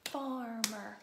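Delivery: "Farmer" loud, split into two even beats; clapped-out syllables. Shown as a demonstration. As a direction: punch each syllable of "Farmer". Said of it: "Do it again".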